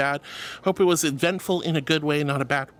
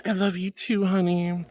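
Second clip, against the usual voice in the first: ordinary talk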